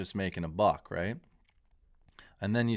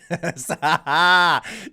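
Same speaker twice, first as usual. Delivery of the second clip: loud laugh; 8 semitones higher, 12 dB louder than usual. Real, not acted. Laughing at a hilarious clip.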